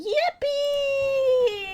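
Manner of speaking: high squealed yippee; gleeful high-pitched yippee; excited cheer. Real, not acted. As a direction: squeal it with joy. Cheering about the game.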